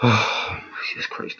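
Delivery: breathy, defeated "Jesus Christ" under a sigh; quiet, worn-out sigh of disbelief; exasperated sigh. Real, not acted. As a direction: let it fall out of you in disbelief. Exasperated reaction to a cringe lyric.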